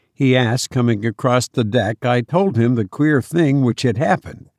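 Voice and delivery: usual speaking voice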